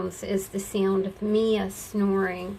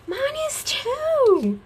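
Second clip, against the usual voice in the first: mock whiny agreement; 5 dB louder than usual. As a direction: squeal it as a mock complaint. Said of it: "And I would go".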